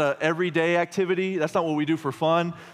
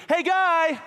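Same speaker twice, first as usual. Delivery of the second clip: put-on very high, drawn-out child's holler; imitated little-kid shout, pitched way up; three-year-old calling out; 3 dB louder than usual. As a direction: holler like a fearless toddler out the car window. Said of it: "She says it twice, that loud".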